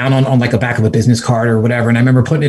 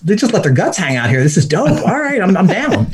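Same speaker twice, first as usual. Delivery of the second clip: excited; 7 semitones higher than usual. Retold or imitated. Inner voice delighted at trail culture.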